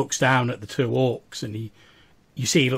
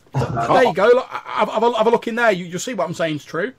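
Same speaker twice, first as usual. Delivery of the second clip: higher, eager frightened voice; nervous appeasing; 10 semitones higher, 8 dB louder, faster than usual. Acted. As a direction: anxiously beg them to believe you. Scared captive hands over pouch.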